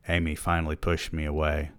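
ordinary delivery, nothing marked

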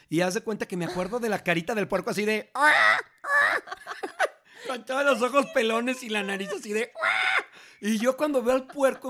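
laughing hard through the words; can't get the words out for laughing, high and loud. Real, not acted. Father laughing as he tells his son about the pig. Laughing while recalling pig's face.

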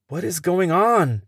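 bewildered cry. Acted. Confused captive cries out.

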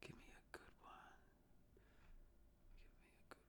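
breathy unvoiced whisper, almost inaudible. Real, not acted. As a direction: drop to a bare whisper pleading for a good comment. Whispered repeat while searching comments.

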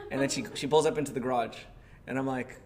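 usual speaking voice

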